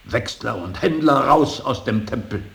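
loud, high, prophetic outcry; fiery cry. Acted. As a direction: hurl it like a preacher driving them out. Casting out money changers, quoting Jesus. (zorniger Ausruf)

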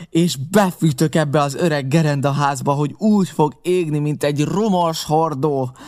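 enraged pirate threatening to burn them out; angry threat. A narrator's reading. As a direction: promise the fire with relish. Pirate's furious parting threat.

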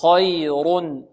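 slow خير with heavy kha; recite with me. Shown as a demonstration. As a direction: model خير slowly. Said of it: "انطقوا معي". (انطقوا معي خير)

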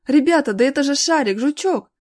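high, loud, joyful cry; acted boyish yelling; frantic yell. A narrator's reading. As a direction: yell to friends on recognising the dogs. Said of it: "истошно завопил Серёга".

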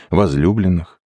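usual speaking voice

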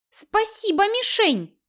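high indignant thanks; offended. Acted. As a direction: huff out a wounded thank-you. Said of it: "оскорбилась красотуля".

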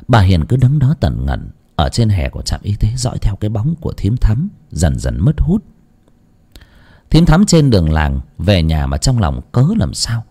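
usual speaking voice

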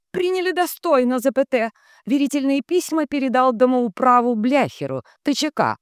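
very high, loud echo; high-pitched relay drone; entranced telegraph operator. A narrator's reading. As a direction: chant out text and punctuation like a transmitter. Dunya echoes the telegram.

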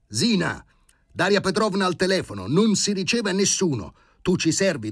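high, loud, urgent commands; frantic high-pitched ordering about; shouting orders. A narrator's reading. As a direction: yell instructions to the whole household. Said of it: "si mise a gridare Filippo Filippovic".

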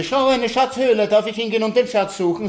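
put-on very high, loud excited child; little boy voice. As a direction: beg eagerly like a small boy. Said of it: "Und wenn er sagte".

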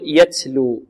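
"يتلو" with an s hiss after the t; over-whispered t sliding into s in "يتلو"; taa tinged with seen. Shown as a demonstration. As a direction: say "يتلو" like "yatslu". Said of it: "نشرب التاء هنا رائحة السين".

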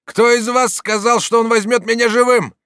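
high, loud shout; challenging exclamation. A narrator's reading. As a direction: dare whoever said it. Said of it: "воскликнул Дон Фернанд". (громкий крик с вызовом)

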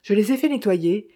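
ordinary delivery, nothing marked

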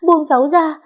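tearful scream to be released; screaming plea. Acted. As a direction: shriek the repeat in desperation. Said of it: "cố gắng vùng vẫy để thoát khỏi bọn họ".

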